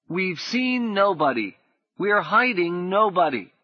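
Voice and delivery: put-on lie bravely like a child; high firm boy; boy's voice. A narrator's reading. Boy denying at door.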